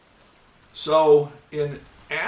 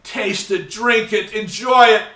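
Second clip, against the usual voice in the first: building louder and higher, a hammed-up ad pitch; overblown ad copy; 5 semitones higher, 9 dB louder than usual. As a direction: sell it like heavenly juice, rising. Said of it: "the copy was".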